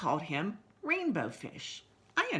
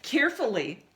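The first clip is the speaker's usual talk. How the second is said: ordinary delivery, nothing marked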